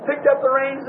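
ordinary talk

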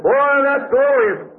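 a mock high-church gush, drawn out; mock admiration. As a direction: parody the proud church gushing. Mocking the great high church.

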